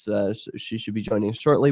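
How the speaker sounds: normal talking voice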